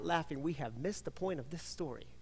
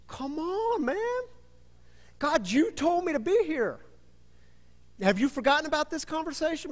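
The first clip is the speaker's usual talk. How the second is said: much higher, louder indignant complaint; exasperated Elijah; 8 semitones higher, 6 dB louder than usual. Acted. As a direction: protest like a frustrated prophet.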